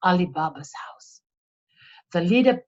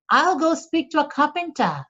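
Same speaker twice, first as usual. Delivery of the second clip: a bit higher, light housekeeper voice; a lightly voiced housekeeper; 7 semitones higher than usual. A narrator's reading. Housekeeper offering Ali Baba help, a touch higher. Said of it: "said the housekeeper".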